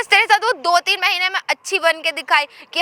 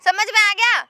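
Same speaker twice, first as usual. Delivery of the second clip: raised, high defiant snap; defiant refusal; 6 semitones higher than usual. Acted. Wife refusing to nurse her mother-in-law, defiant. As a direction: refuse flatly and rub it in. Acted marital quarrel.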